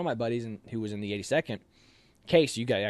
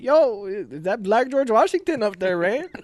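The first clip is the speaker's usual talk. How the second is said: high, loud, disbelieving question; incredulous buddy; 11 semitones higher, 7 dB louder than usual. Retold or imitated. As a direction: play the buddy who can't believe his eyes. Buddy repeats the joke.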